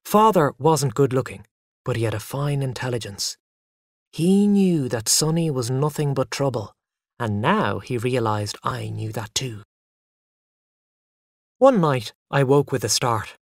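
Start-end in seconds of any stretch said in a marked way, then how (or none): none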